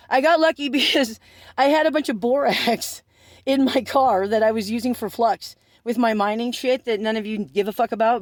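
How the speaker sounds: normal talking voice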